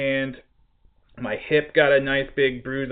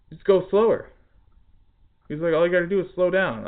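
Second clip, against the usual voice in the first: mocking the boss; 7 semitones higher, 5 dB louder than usual. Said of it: "he looked at me and he goes".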